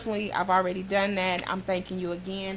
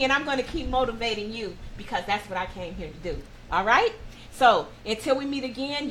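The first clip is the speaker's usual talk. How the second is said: motivational sign-off; 4 dB louder than usual. Acted.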